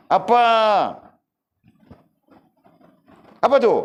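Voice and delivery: very high, loud, exaggerated mock replies; annoyed mother. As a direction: ham up a mother barking back at her child. Mother answers begging child. (ibu menjawab dengan suara sangat tinggi dan keras, dibuat-buat)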